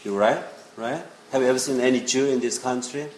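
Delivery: normal talking voice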